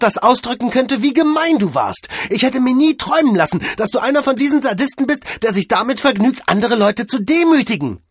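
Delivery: very high, loud outraged scolding; furious tirade. Acted. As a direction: lay into him at full volume, pitch right up. Mavis berates Cordell after the scene. (hohe, laute, empörte Schimpftirade)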